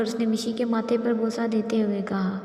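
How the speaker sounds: plain reading voice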